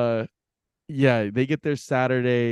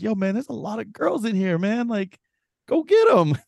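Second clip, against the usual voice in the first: mock hype; 9 semitones higher than usual. Brushing off a clingy stranger at a crowded bar, mock hype. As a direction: hype him up to get rid of him. Telling the stranger to leave him.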